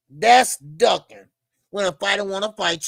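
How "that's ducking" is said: louder and slow, "That's ducking" hammered home; emphatic repeat. Real, not acted. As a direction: lean on every word of "That's ducking".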